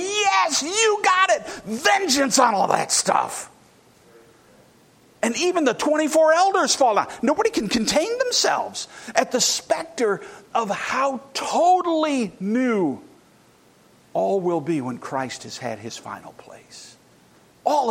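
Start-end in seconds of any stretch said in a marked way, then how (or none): 0.0-3.5 high shouted cry of yes; jubilant shout. Acted. Elders falling down, shouting their agreement to God. 14.8-17.0 sudden hushed whisper. Real, not acted. Preacher whispering about Christ's final reign to the congregation. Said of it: "sudden whisper in sermon".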